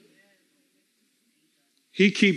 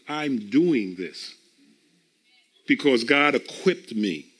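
lower, slow and deliberate; slow and firm; 5 semitones lower, slower than usual. Retold or imitated. Believer declaring faith, low, slow and deliberate. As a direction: plant each word slowly. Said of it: "Let your faith say".

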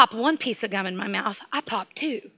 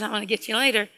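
quiet, slightly lowered mock threat; softly muttered playful threat; under the breath; 2 semitones lower, 6 dB quieter, faster than usual. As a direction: say it softly to yourself. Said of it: "go under my breath".

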